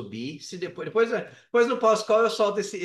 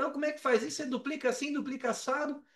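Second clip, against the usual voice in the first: higher, asking questions; 7 semitones higher, 5 dB quieter, faster than usual. Retold or imitated. Quoting himself asking colleagues.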